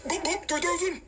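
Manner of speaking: very high squeaky chant; squeaky playful. Real, not acted. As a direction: squeal it like a toy.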